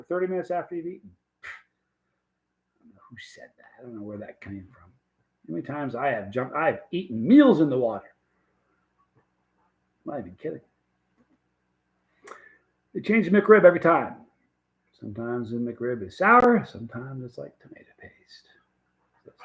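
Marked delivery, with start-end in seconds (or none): 1.4-4.9 breathy whisper, slow, barely audible; slow whispered aside. Real, not acted. Muttered aside about a saying. 16.6-19.4 whispered aside. Real, not acted. Aside about McRib taste.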